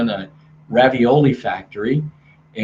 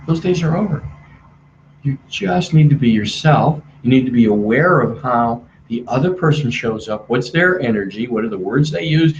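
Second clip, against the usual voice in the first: ordinary delivery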